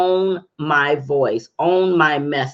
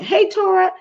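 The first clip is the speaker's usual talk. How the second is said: excited greeting; 14 semitones higher than usual. Real, not acted. Greeting a new viewer.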